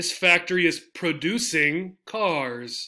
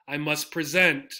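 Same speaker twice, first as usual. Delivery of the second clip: "I must pre-ZENT", stress on the end; second-syllable stress. Shown as a demonstration. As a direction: land on SENT in present.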